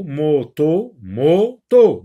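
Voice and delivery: "moto" as "mo... to", each vowel held; teacher drilling the two beats of "moto"; split into syllables. Shown as a demonstration. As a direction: repeat "moto", breaking it harder the second time. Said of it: "Donc il y a deux syllabes".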